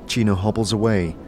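ordinary talk

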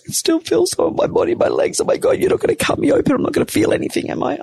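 high, panicky squeal acted out; freaking out. Patient panicking to anaesthetist before being cut open, freaking out. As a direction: replay panic in a thin rush of very high voice. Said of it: "Oh, freaking out".

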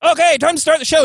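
high-pitched imitation of a stage comedian; mock showman kicking off his act; put-on high comedian voice; Neil Hamburger. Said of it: "And he went".

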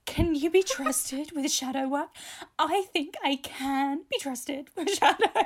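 mock sincere. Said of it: "The sincerity of us".

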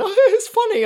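put-on very high, loud giggly kid voice; giggling kid. Kid in back seat answering dad, giggling. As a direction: laugh it out like a cheeky child.

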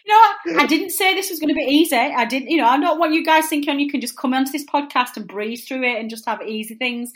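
loud, high-pitched amused protest; playful telling-off. Real, not acted. Host playfully rebukes laughing guest.

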